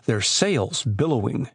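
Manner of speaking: ordinary delivery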